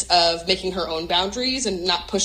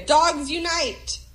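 drawn-out, higher mock rallying cry; mock rally slogan; 9 semitones higher, much slower than usual. Pitching a dog movement to the host, mock rallying. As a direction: proclaim it like a joke protest slogan.

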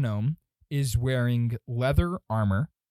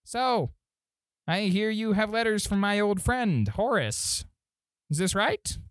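higher-pitched voice for a gnome official; second gnome; 7 semitones higher than usual. Acted. Armored gnome greeting letter bearers, higher official voice. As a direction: pitch up, question them as the one in charge. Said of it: "he walks up and says".

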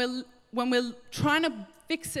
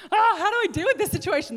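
high, loud, fast fluster; hammed-up panic with a laugh in it; mock panic; 10 semitones higher, 7 dB louder, faster than usual. Imagined flustered reaction to the mob.

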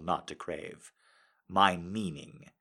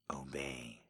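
whispered command; 6 semitones lower, 15 dB quieter than usual. Acted.

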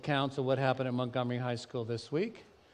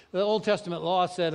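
normal talking voice

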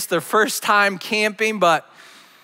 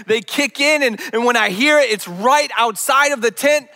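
ordinary delivery

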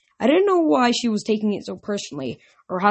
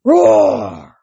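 loud, raised, drawn-out roar; lion roar; 8 semitones higher, 9 dB louder than usual. Acted. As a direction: roar like a great beast. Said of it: "the lion recoiled".

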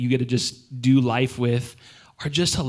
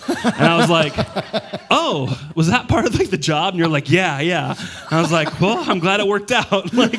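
higher and louder, laughing through it; 6 semitones higher, 4 dB louder than usual. Retold or imitated. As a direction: laugh while telling it. Funny retold exchange, laughing.

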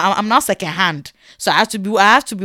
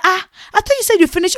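high-pitched disbelieving complaint; retold indignant surprise; dismayed protest; 11 semitones higher, 4 dB louder, faster than usual. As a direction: confront the tailor in high, startled dismay. Confronts tailor over unfinished cloth.

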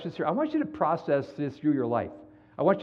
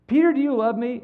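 raised, louder questioning voice of Jesus; Jesus asking; 9 semitones higher, 6 dB louder than usual. Retold or imitated. Jesus pleading with Peter, higher and louder. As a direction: press the question up high.

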